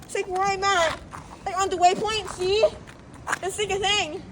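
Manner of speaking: excited. Real, not acted. Excited about waypoint.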